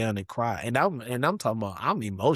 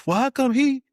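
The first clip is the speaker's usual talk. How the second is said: high, loud indignant question; mock indignant coworker; 7 dB louder than usual. Fired coworkers complaining about favouritism, high and indignant. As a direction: whine it like a jealous coworker.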